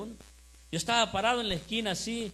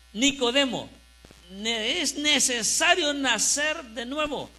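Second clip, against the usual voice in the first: raised, forceful preaching with drawn-out key words; 6 semitones higher, 5 dB louder than usual. Real, not acted. Pastor gives the church its message, preaching emphatically. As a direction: proclaim the verse to the congregation.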